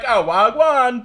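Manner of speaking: high, loud mock Jamaican greeting; Jamaican accent. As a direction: put on a lively Jamaican accent. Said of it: "no fucking around with that accent".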